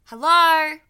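calling out loud into a cave; yelling. Acted. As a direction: shout hello into the dark. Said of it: "she started yelling at the cave door".

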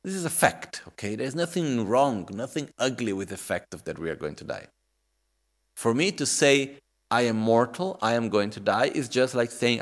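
usual speaking voice

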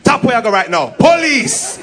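loud, high Jamaican police challenge; Jamaican policeman. Acted. Policeman jumping out at tourist, shouting in Jamaican accent. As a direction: call out sharply in patois. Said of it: "One policeman come out of the bush".

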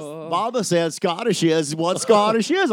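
very slow, drawn-out mock drawl; hammed-up simpleton Southern voice; Forrest Gump. Host parodying the comic's Scottish, slow Gump drawl. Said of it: "Jennifer Gump".

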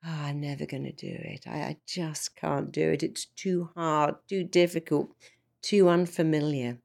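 soft, fast, gloomy lament; defeated. Retold or imitated. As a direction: moan it like you've given up. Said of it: "Or I could sing".

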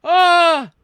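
loud high startled cry; surprised yelp. Real, not acted. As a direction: yelp as you catch the slip. Catches own mistake, yelps.

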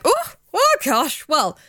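high gasping mock outrage; mock shocked prude. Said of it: "and they're like".

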